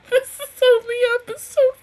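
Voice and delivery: high, loud teary laughter; laughing through tears. Real, not acted. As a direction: laugh while still crying. Laughing and crying.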